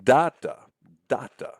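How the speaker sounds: DATA as DAH-da, strong then weak; trochaic stress. Shown as a demonstration. Lecturer sounding out the trochee, first syllable stressed. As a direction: punch the first beat of DATA and drop the second. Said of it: "accent falls on the first syllable".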